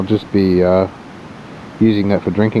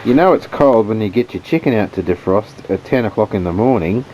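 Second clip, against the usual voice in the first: normal talking voice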